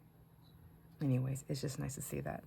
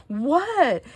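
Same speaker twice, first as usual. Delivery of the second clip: put-on puzzled kid; 10 semitones higher, 12 dB louder than usual. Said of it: "And he's like".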